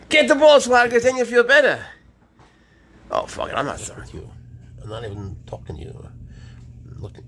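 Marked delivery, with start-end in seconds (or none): 0.1-1.9 shrill urging. Real, not acted. Urging again, higher.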